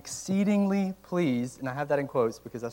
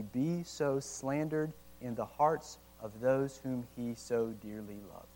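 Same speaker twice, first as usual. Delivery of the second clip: reading slowly; 3 semitones lower, 4 dB quieter, slower than usual. Real, not acted. Pastor reading Owen to the congregation, slow and soft. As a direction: let the archaic words fall gently and slowly. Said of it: "I have to read it slow".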